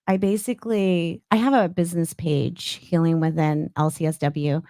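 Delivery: usual speaking voice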